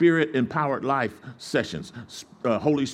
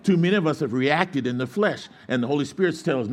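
ordinary talk

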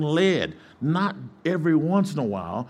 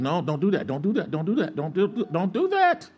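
repeated plea climbing higher and higher; acted urgent warning; 7 semitones higher than usual. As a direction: build from insistent to high-pitched, comic alarm. Holy Spirit urgently warning, acted out.

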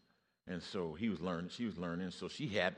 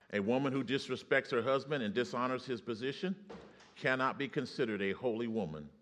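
louder, firm preacher's declaration; preaching; 5 semitones higher, 5 dB louder than usual. Real, not acted. Preacher declaring to the congregation, firm and loud. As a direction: proclaim it to the church.

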